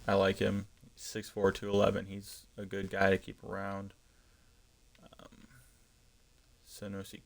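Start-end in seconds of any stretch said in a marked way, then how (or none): none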